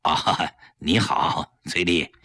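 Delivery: low, subdued greeting with a forced smile; forced friendliness. Acted. 丈夫勉强对妻子的同学打招呼，强颜欢笑. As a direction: greet her through a gloomy mood. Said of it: "强打笑脸和崔丽打招呼".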